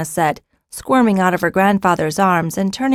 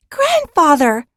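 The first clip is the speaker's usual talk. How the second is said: put-on high, loud happy call of a young girl; girl greeting her grandfather with joy; joyful child cry; 10 semitones higher, 4 dB louder, slower than usual. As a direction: fling the word out in delight.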